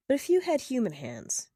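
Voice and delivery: ordinary delivery, nothing marked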